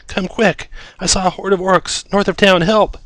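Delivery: high, loud, panicked pleading; frantic villager. Acted. Villager dragging the party north, frantic high plea. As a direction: beg them to hurry, breathless with fear. Villager begging adventurers for help.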